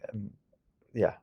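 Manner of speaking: ordinary talk, nothing marked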